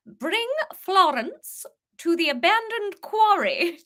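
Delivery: sinister Queen reading a ransom letter; posh English Queen. Acted. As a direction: threaten them like a cold regal kidnapper. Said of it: "worked really weirdly well as the Queen".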